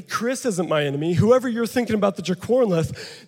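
normal talking voice

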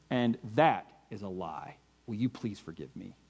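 a softened, heartfelt plea for forgiveness; asking your wife quietly and sincerely to forgive you; quiet sincere apology. Retold or imitated. Confessing to wife in conflict.

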